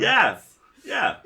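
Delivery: loud, high yeah; excited yeah. Real, not acted. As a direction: cheer it in agreement. Enthusiastic agreement.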